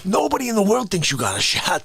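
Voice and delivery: ordinary talk, nothing marked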